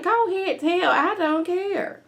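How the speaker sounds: high, slow defiant quote; Nancy's dare. Retold or imitated. Nancy daring them to tell, high and slow. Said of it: "Nancy's like".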